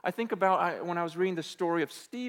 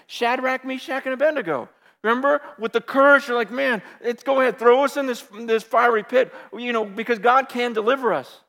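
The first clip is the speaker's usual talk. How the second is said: preaching at full force; 5 semitones higher, 9 dB louder than usual. Acted.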